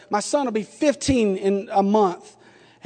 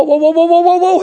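rising alarmed whoa, higher and louder; panicked whoa; 8 semitones higher, 5 dB louder than usual. Retold or imitated. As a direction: yelp it like the car is about to crash. Panicked dad teaching son to drive.

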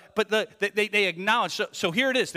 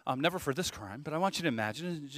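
ordinary talk, nothing marked

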